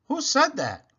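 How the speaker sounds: high alarmed question; startled. A narrator's reading. Mister Watson startled by a stowaway, alarmed. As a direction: jump at the hidden voice.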